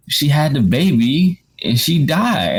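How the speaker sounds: high, slow, choked-up telling of the death; tearful retelling. Retold or imitated. Telling his wife through tears, high and slow. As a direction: relive the sob while explaining. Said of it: "sitting there crying".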